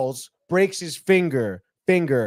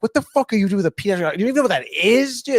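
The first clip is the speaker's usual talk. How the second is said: higher, quick incredulous jeer; retold ribbing; 6 semitones higher, faster than usual. Friends at the table hear of the new girlfriend, mocking. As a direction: make fun of him in a rush of disbelief. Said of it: "they're making fun of them".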